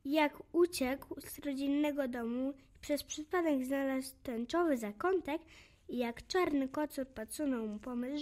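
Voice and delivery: plain reading voice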